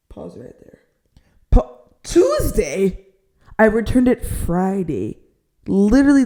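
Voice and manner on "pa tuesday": slow, drawn-out disbelieving Tuesday; questioning the agent's claim, incredulous. Real, not acted.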